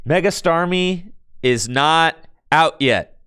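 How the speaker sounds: slow, high, measured announcement to chat; exasperated, drawn-out correction; emphatic correction. Real, not acted. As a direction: spell it out slowly for the viewers.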